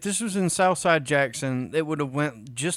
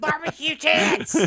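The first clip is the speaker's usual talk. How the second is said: mock panic shout; 12 semitones higher than usual. Acting out rain panic.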